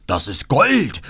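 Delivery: gierig und etwas lauter, in fremder Räuberstimme; „Gold“ mit fallendem Ton gierig betonen; gierige Räuber. Acted. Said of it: "riefen sie und stürzten hervor". (Räuber entdecken die Kutsche: gieriger, fremder Ausruf)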